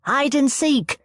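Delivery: put-on high, quick child's pitch; eager suggestion. A narrator's reading. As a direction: jump in with the idea. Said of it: "suggested Jono".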